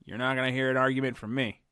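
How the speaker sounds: ordinary talk, nothing marked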